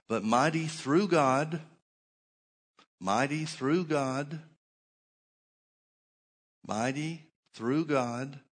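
mighty through God, slow, with "through" rising; slow emphatic repetition. Acted. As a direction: drag each word out, hammering it home. Preacher repeats key phrase.

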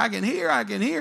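high-pitched, thrilled exclamation; excited cry. Retold or imitated. Healed people running up to the preacher, high excited cry. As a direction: call it out high like someone just healed. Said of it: "They'd run to the platform saying".

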